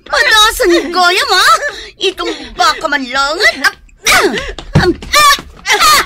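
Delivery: loud, high straining shouts in a brawl; fighting shouts. Acted. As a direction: yell as you wrestle her.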